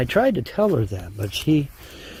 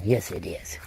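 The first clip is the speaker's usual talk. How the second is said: soft lower whisper; whisper to dog; 8 semitones lower, 7 dB quieter than usual. Real, not acted. Petting the dog, soft lower whisper. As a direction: breathe it to the dog under your voice.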